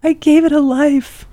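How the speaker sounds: high, whiny mock self-defence; wounded protest. Shamed rat owner defending herself afterwards, high mock-wounded protest.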